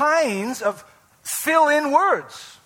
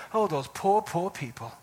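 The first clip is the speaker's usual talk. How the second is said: soft, lowered voice of false pity; mock condescending pity; 5 semitones lower, 7 dB quieter than usual. Preacher imitates moderns pitying the ancients, condescending. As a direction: look down on them with a hushed, patronizing sigh. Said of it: "we look down upon first century people".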